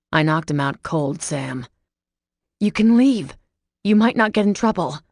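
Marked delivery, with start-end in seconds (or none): 2.6-5.0 higher, louder pleading; urging. A narrator's reading. Sam pleading with Mason to go, higher urgent plea. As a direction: coax him away fast.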